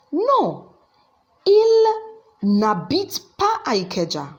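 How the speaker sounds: a firm denial punched high; emphatic no. Shown as a demonstration. Teacher acts out the answer.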